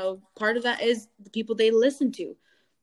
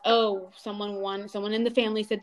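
usual speaking voice

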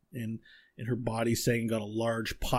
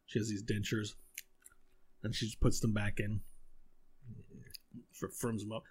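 normal talking voice

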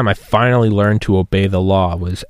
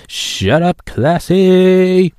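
high, loud cartoon kid voice; South Park voice; 9 semitones higher, 4 dB louder, much slower than usual. Muse telling Classy off, high cartoon voice. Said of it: "God, I love South Park".

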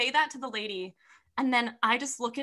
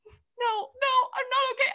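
higher, tearful sob; crying; 12 semitones higher than usual. Retold or imitated. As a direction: blurt it out through tears. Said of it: "And I'm like".

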